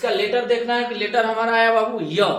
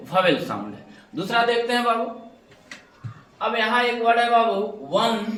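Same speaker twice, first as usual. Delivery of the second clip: normal talking voice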